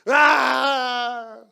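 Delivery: loud high crying shout; child's wail. Acted. Child collapsing, denied ice cream, loud wail. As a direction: burst into a bratty wail. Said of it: "울기 시작하죠".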